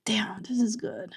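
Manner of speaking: breathless whisper of amazement; awed whisper. Real, not acted. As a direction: whisper it in disbelief. Admiring the cover again.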